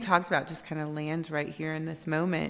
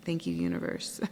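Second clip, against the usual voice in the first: thanks dropped much lower, murmured; 11 semitones lower, 5 dB quieter than usual. Retold or imitated. Thanking the universe after the whisper, low murmur.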